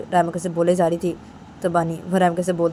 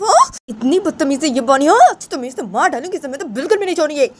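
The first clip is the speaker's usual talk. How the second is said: angry accusation; 6 semitones higher than usual. Acted. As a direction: tear into Bani in front of everyone. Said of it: "तो रायमा से बोलती है". (ऊँची, गुस्सैल इल्ज़ाम लगाती आवाज़)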